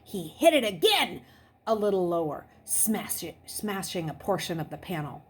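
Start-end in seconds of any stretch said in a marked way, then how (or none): none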